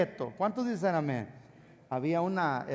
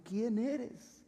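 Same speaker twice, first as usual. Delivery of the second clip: hushed, higher startled question; frightened question; 6 semitones higher, 5 dB quieter than usual. Acted. Startled church cleaner questioning an unseen voice, hushed and frightened. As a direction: ask it softly, pitched up in fright. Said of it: "se espantó, su corazón empezó a palpitar".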